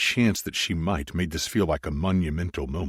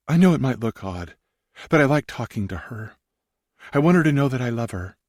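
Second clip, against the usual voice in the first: lightly voiced woman; 7 semitones higher than usual. A narrator's reading. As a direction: voice Sarah gently, a bit higher. Sarah's quoted lines.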